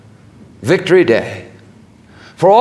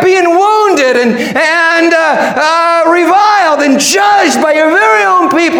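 high, impassioned answer; crying out; 14 semitones higher, 3 dB louder than usual. Acted. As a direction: cry it out at full preaching pitch. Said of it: "And they cry".

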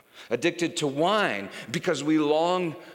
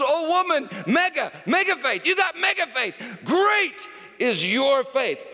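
higher, louder, slowing on the last line; hammed-up jubilant Jesus, much higher; exuberant Jesus; 13 semitones higher, 8 dB louder than usual. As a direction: praise her faith with big enthusiasm. Paraphrasing Jesus with gusto.

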